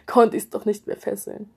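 ordinary delivery

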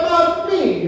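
high, imitated Italian-American son; East Coast Italian accent. Son insisting to his mother, imitated high Italian accent. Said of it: "he had that Eastern Italian accent".

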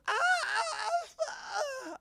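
high, mock squeals of pleasure; mock moaning.

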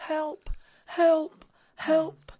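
tiny high-pitched calls; mock cry for help. Drowning Englishman calls for rescue, mock high cry. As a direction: squeak out three separate high cries. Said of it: "se cae al agua y grita".

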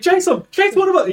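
very high, loud mock panic shout; yelled order. A character urging others to chase, frantic yell. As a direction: call out frantically in a squeaky yell.

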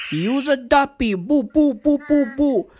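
high-pitched mock Jamaican patois. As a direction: rattle it off in a put-on Jamaican accent. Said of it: "That's how [name] sounds when she speaks".

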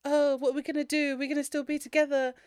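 mocking the teen heroine's plea; mock girlfriend voice. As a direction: parody the heroine's simpering worry. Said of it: "she's like".

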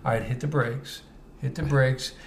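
soft, low retold coaching; calm instruction. Telling a new driver to hit the brakes, calm and soft. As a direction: say it quietly, easing a learner driver along.

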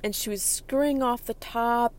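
ordinary talk, nothing marked

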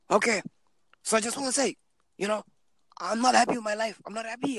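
high, odd put-on greeting; mock weird stranger. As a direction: imitate the strange caller. Imitating same Ugandan guy.

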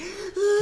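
high, loud mock sob gasp; sobbing gasp. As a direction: burst into a wailing gasp like a child. Boy bursts into tears.

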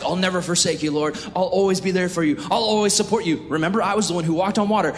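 high, eager vows of loyalty; overeager, self-important disciple; Peter, boastful. Retold or imitated. Acted boastful Peter vowing loyalty.

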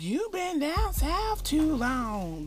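slow, high mock of northern folks; northern mockery. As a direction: drag it out like a northerner teasing a southerner. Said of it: "Like, that's how they sound".

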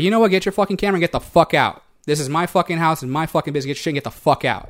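ordinary talk, nothing marked

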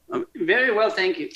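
high, soft put-on voice; put-on customer impression. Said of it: "I love the impression".

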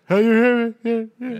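high, loud imitation of a far-heard voice; echoing distant voice. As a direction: call it out high like it's carried from afar. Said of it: "You hear it, like, all like".

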